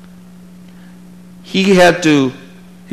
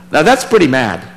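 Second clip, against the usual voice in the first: usual speaking voice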